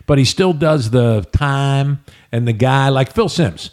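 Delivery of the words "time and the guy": "time" and "guy" with a slow, flattened "ah" vowel; Southern long I. Shown as a demonstration. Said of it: "pretty much mitigated his accent".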